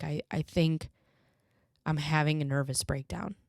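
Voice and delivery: ordinary talk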